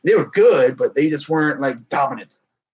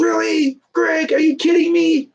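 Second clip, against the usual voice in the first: much higher, exasperated disbelief; retold outrage. Fan retelling his anger at the coach's timeout, high-pitched exasperation. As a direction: fling the question at the coach in outrage. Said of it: "I got so mad when that happened".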